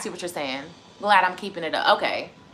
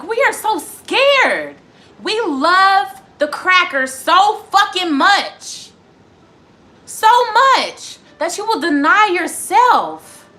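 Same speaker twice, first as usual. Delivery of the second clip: loud, slow, higher pressing tirade; heated rant; 5 semitones higher, 5 dB louder, slower than usual. Real, not acted. Streamer ranting at viewers, loud and heated. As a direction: hammer each phrase home.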